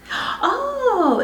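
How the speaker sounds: high-pitched delighted "oh"; delighted surprise. Real, not acted. Unboxing a new palette, delighted surprise. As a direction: let out a high, thrilled oh.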